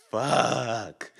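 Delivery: dismayed back-seat curse. Retold or imitated. Friend in the back hearing the speed, soft dismayed curse. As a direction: imitate a quiet, sinking realisation from the back.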